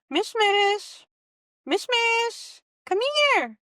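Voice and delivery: high-pitched pet call; calling the cat. Real, not acted. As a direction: coax the cat over in a high voice. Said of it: "Could you try calling over Mish Mish?".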